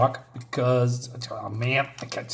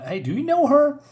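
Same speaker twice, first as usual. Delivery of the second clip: much higher, curious voice; lightly voiced question; 11 semitones higher than usual. Young hero asking his mentor about her, higher and curious. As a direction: pitch it up like an eager young hero. Character asks about the woman.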